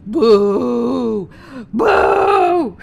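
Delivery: loud drawn-out boo; crowd booing. Retold or imitated. Disgusted crowd booing the performer, loud boo. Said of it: "the all-purpose classic".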